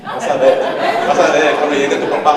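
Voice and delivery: loud, laughing mock warning; laughing singsong threat. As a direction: sing it out gleefully, laughing. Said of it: "She had a little dance".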